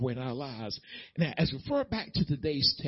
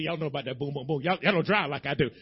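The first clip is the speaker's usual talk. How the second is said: ordinary delivery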